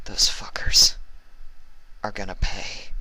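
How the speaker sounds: whispered vow of revenge; muttered. Acted. Vinyl vowing revenge over Ruby, a whispered mutter. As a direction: breathe it out in cold rage. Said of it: "Vinyl muttered".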